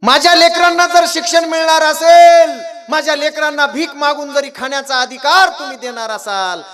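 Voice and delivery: high, full-voiced shout for his children; father's impassioned plea. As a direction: cry out as a father appealing to judges. Father pleads before the Brahmins.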